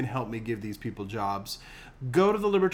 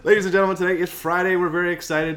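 announcer voice; 6 semitones higher, 8 dB louder, faster than usual. Acted. Host reopens show with fanfare.

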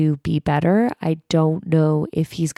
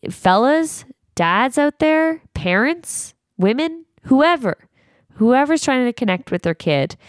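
raised-pitch appeal to the audience; calling out; 8 semitones higher than usual. Real, not acted. Addressing dads and parents listening, calling out. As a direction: address each group in turn, pitched up.

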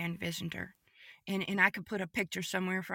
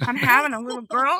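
loud, emphatic announcement; excited, raised voice reliving the news; retold joy; 3 semitones higher, 10 dB louder, faster than usual. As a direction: proclaim it loudly, thrilled.

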